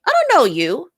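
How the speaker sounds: high, baffled objection; acted affronted questioning; incredulous protest. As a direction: push the pitch up in disbelief. Acted reply to intrusive stranger.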